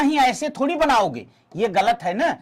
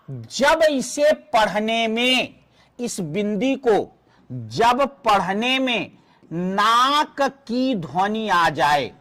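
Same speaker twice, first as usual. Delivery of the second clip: slow teaching voice; slower than usual. Shown as a demonstration. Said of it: "teacher spells out the rule".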